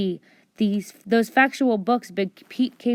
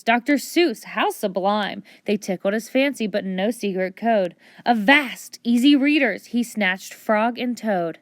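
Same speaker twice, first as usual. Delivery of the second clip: usual speaking voice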